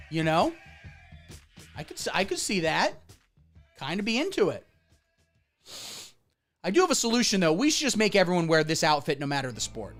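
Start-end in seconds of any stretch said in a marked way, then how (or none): none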